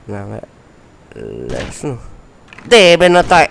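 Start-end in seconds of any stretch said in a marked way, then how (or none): 2.6-3.5 shouting. Real, not acted. Gamer yelling at game.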